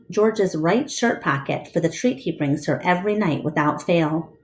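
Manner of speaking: plain reading voice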